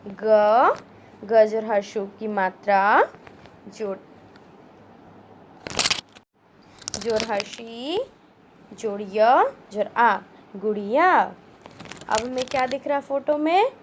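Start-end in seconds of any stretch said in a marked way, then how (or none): 0.1-11.3 put-on slow, spelled-out syllables for children; syllable drill. Shown as a demonstration. Teacher sounding out gulab for children, slow and clear. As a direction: build the word sound by sound.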